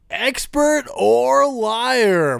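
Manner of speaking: high, very slow dramatic announcer; game show announcer. Acted. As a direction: stretch it out like a quiz host.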